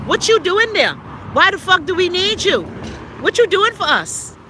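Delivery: rising indignant demand at politicians; angry challenge. Real, not acted. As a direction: call them out, fed up. Angry rant at useless legislators.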